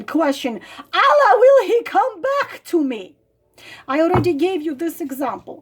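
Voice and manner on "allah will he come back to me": higher, fast whiny plea; mock pleading. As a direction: imitate her begging.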